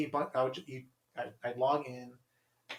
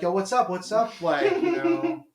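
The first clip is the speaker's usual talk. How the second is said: loud, high eager bro greeting; eager chat guys; 8 semitones higher, 8 dB louder than usual. Retold or imitated. Mimicking men in chat rooms, loud high eager greeting. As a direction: act like a pushy guy pouncing on her.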